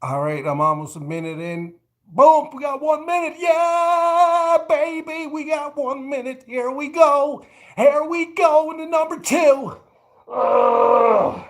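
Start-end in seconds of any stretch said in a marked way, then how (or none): 2.1-9.8 high, loud hype mid-plank; straining excitement. Real, not acted. Pumping himself up mid-plank. 10.3-11.4 strained grunt. Real, not acted. Straining in plank.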